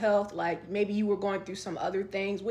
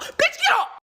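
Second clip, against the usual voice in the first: much higher, loud shout; furious yell; 6 dB louder than usual. Retold or imitated. Reenacting yelling at the boyfriend to get up, a furious shout. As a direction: scream it at him.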